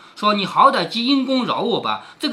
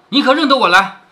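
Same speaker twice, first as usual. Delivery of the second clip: forceful demand; 4 semitones higher, 7 dB louder than usual. A narrator's reading. Attacker demands recognition from victim.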